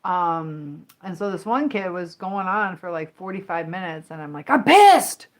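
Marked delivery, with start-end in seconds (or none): 4.5-5.3 loud, high furious yell; losing it, shouting high and loud; angry outburst. Retold or imitated. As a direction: blow up at the kid. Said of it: "Okay, I kinda lost my".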